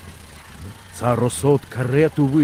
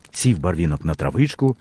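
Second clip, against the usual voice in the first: usual speaking voice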